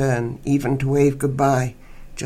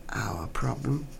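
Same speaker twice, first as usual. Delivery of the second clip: sceptical whisper; 2 semitones lower, 8 dB quieter, slower than usual. A narrator's reading. Narrator mocking the escort's words, whispered. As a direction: whisper it back in disbelief, much softer.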